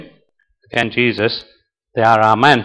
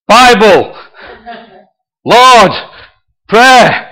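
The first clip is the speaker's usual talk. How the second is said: high, loud one-word shouts; shouting; 10 semitones higher, 7 dB louder, much slower than usual. Acted. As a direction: shout desperate guesses, pausing after each. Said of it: "he's shouting all these words out".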